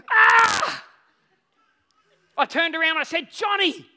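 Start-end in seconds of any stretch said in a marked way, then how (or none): 0.1-0.8 loud high scream of frustration; anguished yell. Retold or imitated. Rod sinks, father cries out. 3.3-3.9 put-on high, full-volume shouting at a child; angry scolding. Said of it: "I'm getting quite angry and frustrated at him".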